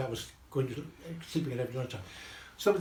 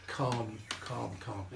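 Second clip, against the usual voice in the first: hushed, slowing repetition; calming; 4 semitones lower, 8 dB quieter, much slower than usual. Acted. Repeating heart-class advice to stay calm, hushed. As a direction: let each calm sink softer and slower.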